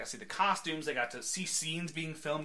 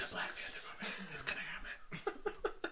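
laughing whisper; 4 semitones higher, 9 dB quieter than usual. Real, not acted. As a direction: whisper it giggling.

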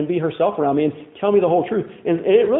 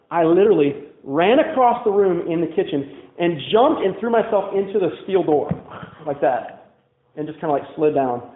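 normal talking voice

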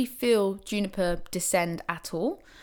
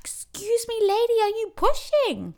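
much higher, breathy whispered then voiced question; high receptionist voice. Receptionist asking the labouring woman, high and startled.

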